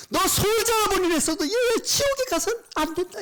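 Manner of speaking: very high, loud pleading shout; God crying out. Retold or imitated. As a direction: cry out desperately to stop a beloved child. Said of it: "이렇게 외치는 거예요". (높고 크게 울부짖듯 외치는 소리)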